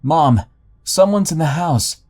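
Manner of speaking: high, loud panicked shout. Retold or imitated. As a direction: yell for your mom in alarm.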